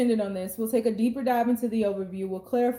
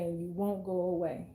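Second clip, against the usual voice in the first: the repeated words dropped lower and softer; quiet, lower echo of the line; 4 semitones lower, 8 dB quieter than usual. Shown as a demonstration. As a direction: let the repeat fade down low. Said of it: "And they're purposely trying to push you away".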